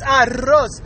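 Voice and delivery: slow "arroz" with a long trilled R; rolled R. Shown as a demonstration. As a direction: correct them with a trilled "arroz". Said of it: "Why you gotta talk like that?".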